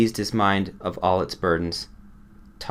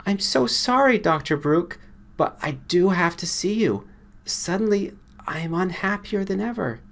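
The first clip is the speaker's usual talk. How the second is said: higher, softened pleading voice; softened plea; 11 semitones higher than usual. A narrator's reading. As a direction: beg him gently, full of sorrow. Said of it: "then softened and said".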